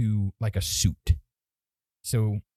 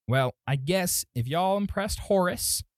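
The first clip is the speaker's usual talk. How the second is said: higher-pitched folksy gnome; y'all kind of voice; 5 semitones higher than usual. Acted. Gnome conceding to Horace's recruits, higher y'all voice. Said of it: "y'all and that kind of voice".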